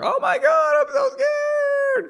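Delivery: very high falsetto squeal, drawn out; hammed-up scaredy-cat wail, ridiculing the character; mock fear. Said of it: "Henry's eyes went wide in fear".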